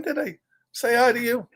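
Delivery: plain reading voice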